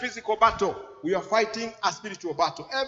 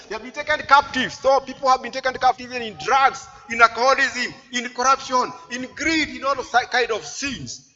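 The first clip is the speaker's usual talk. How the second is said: raised, forceful preaching voice; impassioned preaching; 4 semitones higher, 6 dB louder than usual. Real, not acted. Preacher warning of people taken captive, impassioned preaching. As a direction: hammer out the list item by item.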